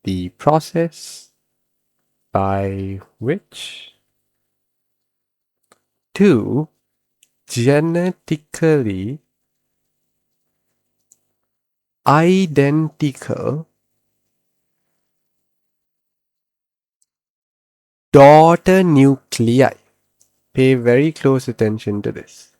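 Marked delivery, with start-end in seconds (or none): 0.0-3.9 slow and soft, with a pause midway; drawn-out, softer dictation pace. Shown as a demonstration. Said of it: "let's take the definition slowly". 6.1-19.7 very slow, long pauses between words; drawn-out words, paced for note-taking; slow dictation. Shown as a demonstration. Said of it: "let's take the definition slowly".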